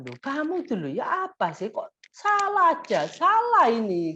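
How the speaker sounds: high-pitched nagging that climbs on "kok salah aja"; exasperated scolding. Shown as a demonstration. Mother scolding a child in a high voice, exasperated. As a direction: nag the child for always being wrong. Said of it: "plus pakai suara tinggi".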